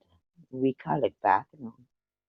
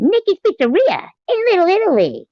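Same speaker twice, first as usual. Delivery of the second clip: much higher and louder chat reading; squeaky high voice; 7 dB louder than usual. Acted. Reading a viewer's chat message in a squeaky voice. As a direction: squeak out the line.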